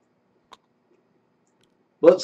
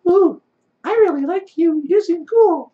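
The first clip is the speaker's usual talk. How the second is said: high, cooing and dazzled; smitten high voice; 14 semitones higher than usual. Acted. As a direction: coo like someone instantly charmed. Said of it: "this guy's like".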